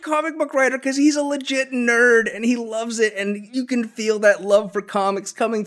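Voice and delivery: high-pitched fannish gushing; squealing nerdy enthusiasm. Real, not acted. Gushing over a favourite comic book writer.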